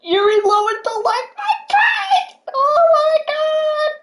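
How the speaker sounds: very high, slow, stretched-out squeal; ecstatic disbelief. Real, not acted. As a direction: drag every word out in giddy shock. Fan sees idol liked tweet.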